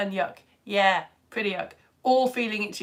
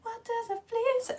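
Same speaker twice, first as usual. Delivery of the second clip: much higher put-on voice, softer; silly flea riddle voice; 13 semitones higher, 5 dB quieter than usual. Shown as a demonstration. Reading Jacob's comment once more, silly high voice. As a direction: squeak the question playfully. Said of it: "there's Jacob's comment again".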